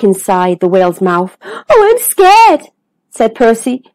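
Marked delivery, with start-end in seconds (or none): 1.4-2.7 high, slow, drawn-out worry; scared. Acted. Percy inside the whale's mouth, scared. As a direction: stretch it out high and fearful.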